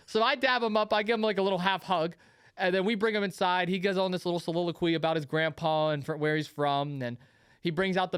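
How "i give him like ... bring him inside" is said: normal talking voice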